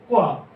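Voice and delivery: क्वा said as one gliding syllable, kwa, firm and falling; compound consonant kwa. Shown as a demonstration. Teacher answering her own question to the class, firmly saying क्वा. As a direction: blend k and w into क्वा, short and falling. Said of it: "के और डब्ल्यू से मिलके क्या बना है?".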